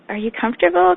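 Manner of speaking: high, loud teasing question; playful question. Retold or imitated. Asking husband on the couch.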